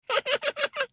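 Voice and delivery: very high, thin squealing cackle; a tiny creature's huge shrill laugh; squeaky monkey laugh way up high. Acted. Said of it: "her laugh was ten times bigger than herself".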